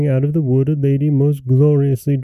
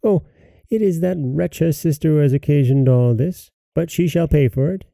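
high, dismayed mother voice; crying out; 5 semitones higher than usual. A narrator's reading. Mother blaming the sister for the toads, dismayed cry. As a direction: exclaim and blame in raised pitch. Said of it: "cried the mother".

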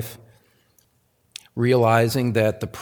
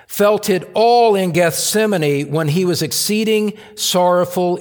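loud, higher, impassioned quote; fervent preaching; 6 semitones higher, 6 dB louder than usual. Acted. Pastor reading Spurgeon's consolation aloud, loud and impassioned. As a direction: preach the quote with full force.

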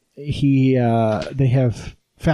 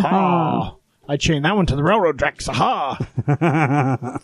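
hammy mustache-twirling villain laugh; cartoon villain; slower than usual. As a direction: gloat like a silent-film villain. Cartoon villain, railroad tracks.

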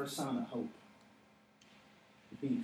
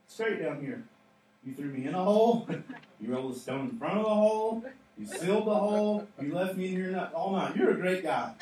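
raised voice, climbing as the list builds; mock yell back; 4 semitones higher, 6 dB louder than usual. Preacher as Daniel listing what the king did to him, mock yell. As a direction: call up the complaints in playful mock praise. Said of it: "He can yell back".

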